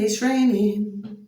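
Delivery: it's raining stretched slow, each word drawn out; slow, weary it's raining; slow drawn-out repeat. Real, not acted. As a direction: drag out it's raining in mock dismay.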